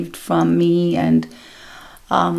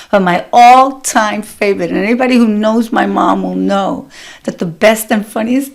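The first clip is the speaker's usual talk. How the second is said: usual speaking voice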